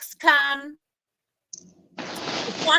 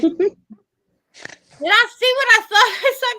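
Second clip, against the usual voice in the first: high-pitched startled retold question; retelling her stunned reaction, much higher and louder; mock disbelief; 10 semitones higher, 7 dB louder than usual. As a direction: squeak it out in shocked disbelief. Retold shock seeing coyote.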